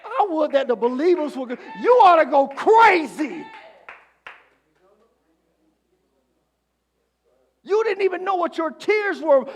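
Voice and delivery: high, loud preaching outburst; shouting preacher. Acted. Preacher to believers who should go crazy, shouting. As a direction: explode at the believers.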